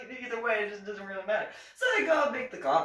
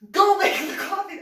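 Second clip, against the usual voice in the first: much higher, loud shouted command; furious order; 11 semitones higher, 10 dB louder than usual. Retold or imitated. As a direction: yell the order at the private. Captain ordering her angrily.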